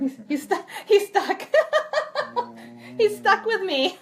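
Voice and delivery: high, loud, laughing through the words. Real, not acted. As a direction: giggle it out, barely holding together. Laughing at her own joke.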